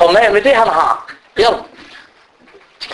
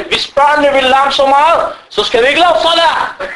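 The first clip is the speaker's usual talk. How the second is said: stern complaint; 9 semitones higher than usual. Retold or imitated. Sheikh complains about noisy children.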